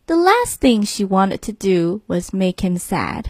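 plain reading voice

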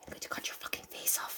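voiceless mock-menacing whisper; whispered threat. Reader joining in threatening Jack, whispered menace. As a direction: whisper it like a pretend killer.

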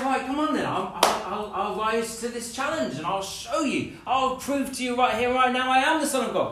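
defiant boast. Pretend. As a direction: shout it like you're proving yourself. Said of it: "you'd say".

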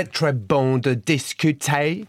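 très bon de discuter in English sounds; French with English accent. Shown as a demonstration. Guest retries after being too French, English-accented French. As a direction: say the French like a Briton. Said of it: "Okay, that's better".

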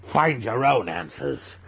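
high, slow, spat-out curse; the master cursing the boy before killing him; murderous snarl. A narrator's reading.

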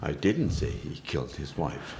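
"didn't" stressed and rising. Shown as a demonstration. Next in the emphasis game, stress on "didn't". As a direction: hit "didn't" hard, the rest easy. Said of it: "put the inflection on the second".

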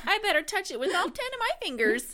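high, loud, fast dopey detective; old-timey detective. Retold or imitated. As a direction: ham up a clueless cop. Mocking cheesy reenactment detectives.

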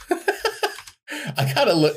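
high giggle through the words; laughing. Real, not acted. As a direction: laugh while you say it. Laughing at fake quote.

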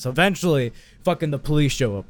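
usual speaking voice

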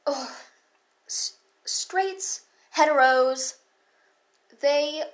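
ordinary talk, nothing marked

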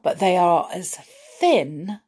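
plain reading voice

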